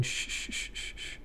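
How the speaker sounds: soft whispered shh; quiet the panic with a gentle hush; soothing shush. Retold or imitated. Lifeguard calming a flailing drowning victim, whispered shushing. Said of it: "The lifeguard gets in there".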